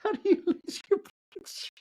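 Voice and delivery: high, cracking up mid-question; can't get it out for laughing. Real, not acted. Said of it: "laughing at own bit".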